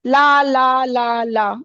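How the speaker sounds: slow, even ला, ला, ला with no syllable stressed; unstressed, easy syllables. Shown as a demonstration. Teacher demonstrates pyrrhic foot, every ला even and unstressed. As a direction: let each ला glide evenly, sinking at the end. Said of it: "इसको आप आराम से बोल रहे हो ना".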